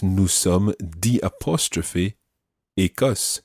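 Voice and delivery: naming the apostrophe slowly; slow explanatory breakdown; slowed, spelled-out phrase. Shown as a demonstration. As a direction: break it apart for learners. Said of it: "Now watch that".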